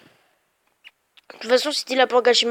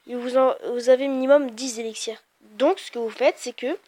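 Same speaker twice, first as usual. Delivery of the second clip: ordinary talk, nothing marked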